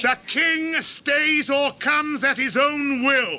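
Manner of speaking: slow, loud, high call. Acted. Aragorn answers the orcs from the wall, slow and loud. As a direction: answer them firm and slow.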